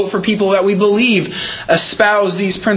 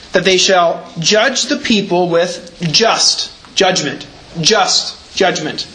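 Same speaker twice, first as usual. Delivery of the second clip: slow emphasis; much slower than usual. Shown as a demonstration. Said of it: "stated very positively".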